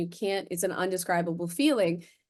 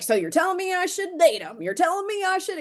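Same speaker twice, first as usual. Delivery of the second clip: much higher, pointed sarcastic question; defiant twisting; 11 semitones higher, 4 dB louder than usual. Retold or imitated. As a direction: twist God's words on purpose. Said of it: "And I'm like".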